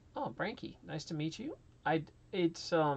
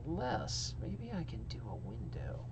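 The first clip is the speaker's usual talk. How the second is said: whispered aside, thinking aloud; half to yourself, working out an idea; whisper to self; 3 semitones lower than usual. Real, not acted. Streamer thinking aloud to himself while fiddling with settings, whispered.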